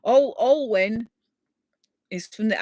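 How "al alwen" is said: Alwen said slowly as AWL-wen; careful pronunciation. Shown as a demonstration. Introducing the viewer to everyone, Alwen said slowly. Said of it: "got me to pronounce his first name".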